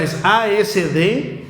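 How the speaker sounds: ordinary delivery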